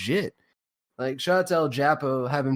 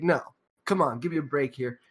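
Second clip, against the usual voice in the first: usual speaking voice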